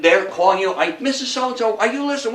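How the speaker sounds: usual speaking voice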